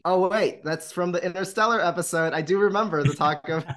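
excited realization. Real, not acted. Host remembering the Interstellar episode, excited and loud. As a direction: burst out as it clicks.

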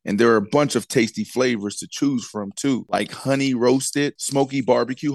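smooth sponsor read, steady pace. Acted. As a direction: read it like a radio spot. Host reads sponsor ad.

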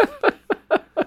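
very high squealing creature laugh; Bigfoot voice. Acted. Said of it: "their Bigfoot voice was like this".

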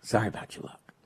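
whispered tease. Real, not acted. Teasing losing caller.

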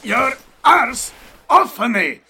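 loud, high, slow yell; angry shout. Acted. Pinned thug yelling at his captors. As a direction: bellow at them to get off.

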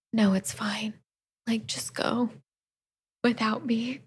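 a soft, breathy whisper, dramatically noble; mock martyr. Hungry boyfriend dramatically urging her to go, in a whispered voice. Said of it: "he's really hungry and he's like".

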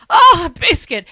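loud delighted gasp; overjoyed at a biscuit; mock delight. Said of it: "looking at a biscuit and going".

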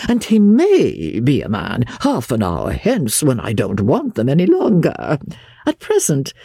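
affected, high-swooping peevish gentleman; fussy invalid master. Acted. Invalid scolding his visitor over the valet, affectedly peevish. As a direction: protest with languid, exaggerated disbelief.

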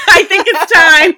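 words squeezed out through real giggles; laughing. Real, not acted. Cohost cues the Banderas section, she answers laughing. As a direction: laugh right through the line.

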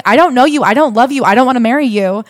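high, loud mimicked rejection; insistent refusal. Retelling what she told him to his face, insistent refusal. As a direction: hammer out each refusal as if quoting her to prove a point.

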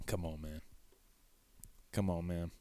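low, quiet mutter of disbelief; under the breath, exasperated. Real, not acted. Muttered disbelief at caller question.

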